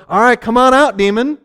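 a half-hearted commanding call; ordering the demon out with mock bravado. Disciples ordering the demon out, in a mock command.

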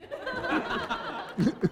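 put-on high, soft squeal of a little girl; imitated little girl squeal. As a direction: mimic the girl's tiny squeal. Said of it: "She goes".

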